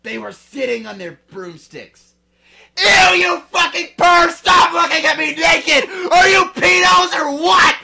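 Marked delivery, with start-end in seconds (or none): 2.8-7.8 high, very loud shrieking; screaming. Acted. Girl screaming at spying teachers, disgusted shriek. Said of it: "I screamed".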